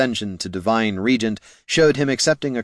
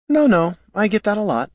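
much higher, polite scribe voice; mild-mannered scribe; 11 semitones higher, 3 dB louder than usual. Acted. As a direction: brush off an apology in a high voice. Scribe waves away an apology.

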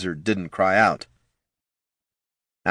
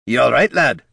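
louder, higher character voice; gruff comrade checking in; 5 semitones higher, 6 dB louder than usual. A narrator's reading. Gorm helping Jin to his feet, concerned call. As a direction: call it out to a fallen friend.

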